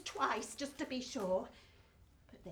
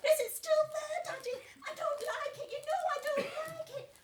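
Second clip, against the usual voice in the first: put-on much higher, whimpering child; scared little girl; 13 semitones higher than usual. Little Betsy asking her daddy, high and whimpering. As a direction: whine high like a frightened small girl.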